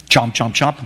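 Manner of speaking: low mock chewing sounds, chomp chomp; playful munching sound effect; chomping noises. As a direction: mimic a snake munching fruit. Serpent eating the fruit.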